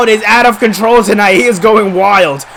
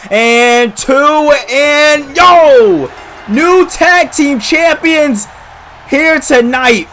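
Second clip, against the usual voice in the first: shouting; 5 semitones higher, much slower than usual. Real, not acted. Calling the title win.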